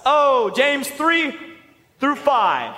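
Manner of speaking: high, drawn-out feigned surprise; mock discovery. Preacher pretending to find the perfect verse, mock-surprised. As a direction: draw it out as a pretend lucky find. Pretends to stumble on a fitting book.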